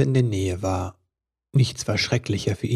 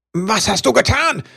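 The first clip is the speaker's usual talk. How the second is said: much higher, sharp angry question; snapping at her; 10 semitones higher, 3 dB louder than usual. A narrator's reading. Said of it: "fuhr Ribbelwitsch sie an".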